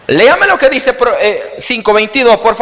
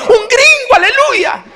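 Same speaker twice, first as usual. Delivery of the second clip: laughing mock prophet.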